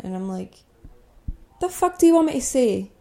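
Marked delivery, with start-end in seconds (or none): 1.6-2.9 high-pitched, indignant comeback; retold outburst of exasperation, pitched up; exasperated retort. Teen fed up with being made to say things, exasperated. Retelling exasperation at classmates' demands.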